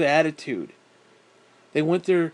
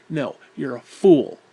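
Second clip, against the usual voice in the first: usual speaking voice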